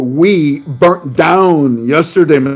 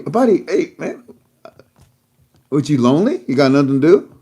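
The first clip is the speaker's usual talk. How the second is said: ordinary delivery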